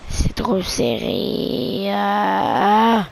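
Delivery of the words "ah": ordinary delivery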